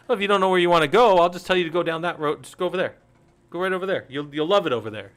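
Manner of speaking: hammed-up know-it-all pointing the way; mock Cheshire Cat. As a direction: rattle it off like a smug cat shooing you down any road. Mocking the Cheshire Cat's advice.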